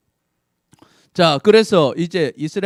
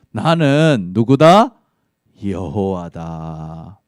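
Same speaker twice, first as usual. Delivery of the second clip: voice of God; 7 semitones lower, 4 dB louder, much slower than usual. Acted. God answers Moses.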